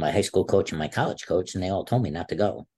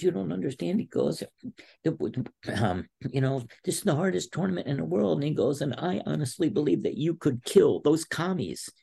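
coach voiced; 9 semitones higher than usual.

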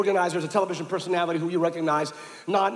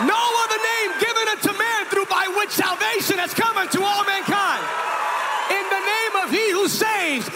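preaching at full force; 13 semitones higher than usual. Acted. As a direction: roar each name to the crowd.

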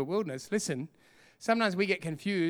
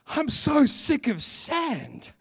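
fed-up whine; 6 semitones higher, 3 dB louder, much slower than usual. Retold or imitated. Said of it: "till we're like".

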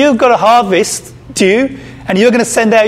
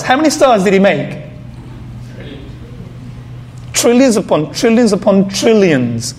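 usual speaking voice

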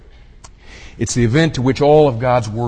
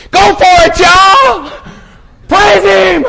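high, full-throated rallying shouts; very high, loud bursts of urging; cheerleader yell; 10 dB louder, slower than usual. Acted. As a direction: whip up the crowd like a cheerleader. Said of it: "almost like a cheerleader".

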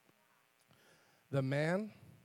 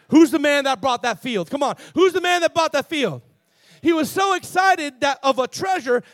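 loud, high, challenging sermon call; full-force preaching; 5 semitones higher, 8 dB louder than usual. Real, not acted. Preacher asking who bought the field, full-force preaching. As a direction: fire the question out to the room.

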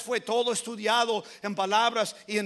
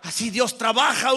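emphatic proclamation; 8 dB louder than usual. Real, not acted. Preacher drives home how God works, loud and emphatic. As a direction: launch into full preaching voice.

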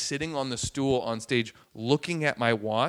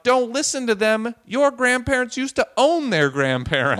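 loud, high imitated shout; retold yell; 10 semitones higher, 9 dB louder than usual. Man at back of audience to comic, yelling. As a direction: holler it like a heckler. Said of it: "yells out".

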